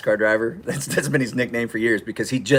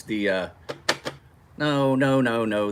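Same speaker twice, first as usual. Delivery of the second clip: ordinary talk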